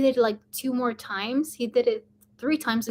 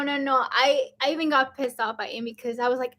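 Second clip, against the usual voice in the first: ordinary talk, nothing marked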